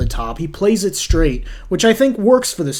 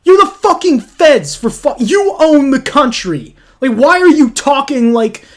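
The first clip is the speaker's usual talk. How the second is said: exasperated rant; 6 semitones higher, 5 dB louder than usual. Real, not acted. Ranting at the movie's characters.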